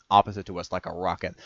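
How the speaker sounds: plain reading voice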